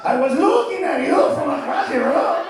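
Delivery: put-on loud, high Mexican accent. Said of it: "Mexican accent is not as hot".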